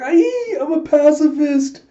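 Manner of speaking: very high, loud squeal; hammed-up cowardly shriek; panicked plea. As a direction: squeal it while fleeing. Chaos runs away pleading.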